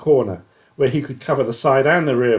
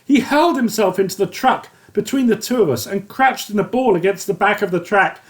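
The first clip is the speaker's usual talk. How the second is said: plain reading voice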